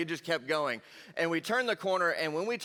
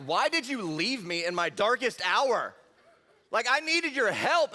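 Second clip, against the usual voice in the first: slow, theatrical scolding; melodramatic reproach; 4 semitones higher, slower than usual. Retold or imitated. Teen confronting friends who fled, melodramatic reproach. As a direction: wail it slowly, milking the betrayal.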